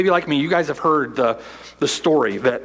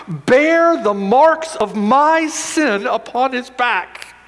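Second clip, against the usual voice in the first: fiery preaching; 6 semitones higher than usual. Acted. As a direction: thunder it from the pulpit, drawing out each phrase. Preaching at full force.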